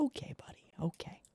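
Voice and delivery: hushed, whispered mock humoring; soft breath-only placating murmur; whispered aside. Sarcastic aside to the character.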